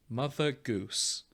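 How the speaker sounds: normal talking voice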